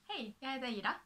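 a quick Swedish self-introduction. Shown as a demonstration. Showing off her Swedish to viewers, in Swedish. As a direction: say it as a native Swedish speaker. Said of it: "I speak five languages".

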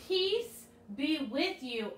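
put-on drawn-out words for kids to echo; slow call-and-response. Shown as a demonstration. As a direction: say it slowly so they can follow. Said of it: "So say that with me".

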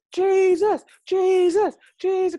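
high, loud repeated cry; whooping, high-pitched acclaim; excited shouting. Retold or imitated. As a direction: shout the name over and over in celebration. Her shouting amid celebration.

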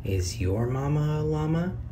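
ordinary delivery

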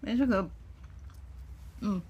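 chewing while talking; speaking around food; mouth full. Real, not acted. Seen 1: pops fried potato bite into mouth, chewing throughout labelled words.

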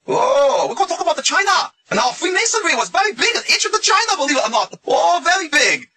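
very high-pitched mock Chinese accent. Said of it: "I'm not making fun of the Chinese accent".

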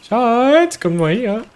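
loud call to come over; yelling across the room. Real, not acted. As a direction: call her over from afar. Calls partner over across room.

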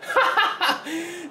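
high burst of giddy laughter; delighted laugh. Real, not acted. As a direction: laugh out of happy surprise. Laughing at goal surprise.

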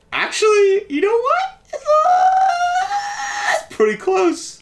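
high laughing shriek; excited shriek. Real, not acted. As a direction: squeal it out, laughing. Shrieking at a game resemblance.

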